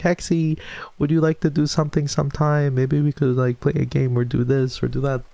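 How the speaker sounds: high, sing-song friendly invitation; sweet imitation. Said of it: "was so sweet".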